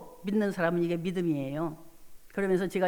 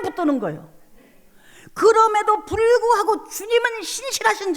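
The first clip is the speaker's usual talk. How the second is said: preaching at full force; 10 semitones higher, 8 dB louder than usual. Acted. A pastor telling the congregation she kept holding to God, preaching hard. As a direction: proclaim each line like a trumpet blast. Preaching her faith aloud.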